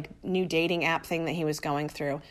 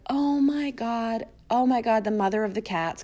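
gushing excitement; 5 semitones higher than usual. Retold or imitated. Flight attendant's gushing messages retold.

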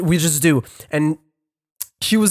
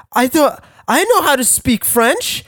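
panicking; 11 semitones higher, 3 dB louder than usual. Retold or imitated. Retold panic about losing French.

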